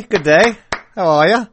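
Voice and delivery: hammed-up Australian greeting; mock Australian accent, put on for a joke; poor Australian accent. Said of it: "My Australian accent is very poor".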